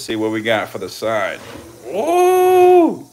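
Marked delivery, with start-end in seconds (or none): 1.9-3.1 sudden high yelp of surprise; startled ooh. Real, not acted. Startled by the snake, a sudden high ooh. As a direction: jump at the strike.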